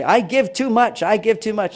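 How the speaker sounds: mock proud martyr; proud complaint. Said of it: "Women say that with badges".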